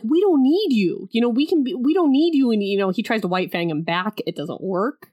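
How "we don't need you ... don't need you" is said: mock defiance. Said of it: "Davy's like".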